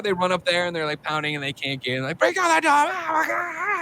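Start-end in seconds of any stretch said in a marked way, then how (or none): 2.2-3.8 high hammed-up screams; hammed-up terrified yelling; mock panicked screaming. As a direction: act out a frantic break-in. Retold panic, everybody screaming.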